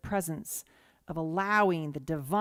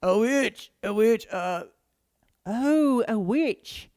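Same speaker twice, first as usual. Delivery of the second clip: a hint of a puppet voice, a bit louder; 6 dB louder, much slower than usual. A speaker testing a silly puppet-like voice. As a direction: mutter it like a hand puppet. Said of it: "that's a puppet voice".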